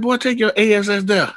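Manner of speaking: high, laughing bossy voice; laughing James impression. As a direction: play the big friend ordering him, laughing. James voiced in a retold story.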